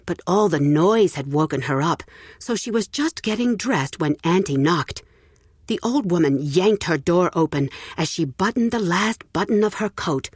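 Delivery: usual speaking voice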